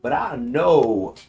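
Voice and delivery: slow, lightly drawled Southern lawyer; Southern country lawyer. Acted. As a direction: play the humble Southern country lawyer, slowly. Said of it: "it's like".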